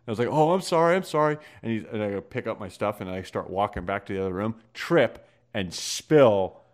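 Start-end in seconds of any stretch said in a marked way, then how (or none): 0.3-1.4 higher, hurried mock apology; retold sheepish sorry, pitched up; flustered apology. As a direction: imitate a kid apologising in a fluster.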